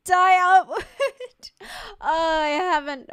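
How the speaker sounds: high, loud, laughing through the words; helpless laughter. Real, not acted. Streamer cracking up at a chat message, laughing loudly. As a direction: crack up as you speak, pitch shooting up.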